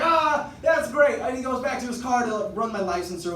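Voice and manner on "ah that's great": amused cop. Retold or imitated. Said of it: "He's like".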